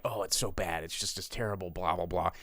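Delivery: low, soft parody of complainers; quiet, low blah-blah imitation; mock dismissive critics. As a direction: rattle off other people's gripes dismissively.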